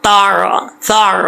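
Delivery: slow تار ثار with a throat ain; sounding drill. Shown as a demonstration. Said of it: "پھر اس کو sound میں پڑھیں،".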